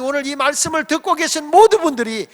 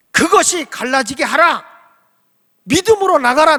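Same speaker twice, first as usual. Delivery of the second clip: commanding God; 5 semitones higher, 8 dB louder than usual. Retold or imitated. God commands, preacher builds.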